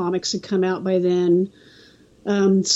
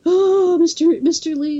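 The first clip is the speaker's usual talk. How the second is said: high, louder stammered greeting; acting out an awestruck little fan, pitched up; starstruck kid; 9 semitones higher, 4 dB louder than usual. Kid reaching Stan Lee's table, starstruck.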